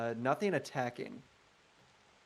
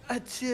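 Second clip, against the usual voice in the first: playful high fake sneeze; a quick mock achoo to get blessed; 10 semitones higher, 4 dB louder than usual. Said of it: "If you sneeze, I'll bless you".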